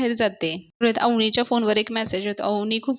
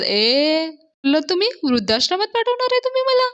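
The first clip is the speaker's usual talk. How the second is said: high, alarmed mock panic; mock fright; 7 semitones higher than usual. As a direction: shriek it like a frightened old man. Anna scared of old-age home.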